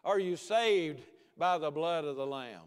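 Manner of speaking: ordinary talk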